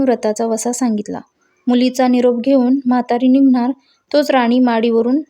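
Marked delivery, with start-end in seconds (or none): none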